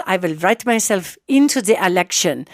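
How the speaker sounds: badly faked Russian accent; terrible Russian accent. Imitating Putin writing himself in, terrible Russian accent. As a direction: attempt a Russian accent and miss, landing near German. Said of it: "that was a terrible Russian accent".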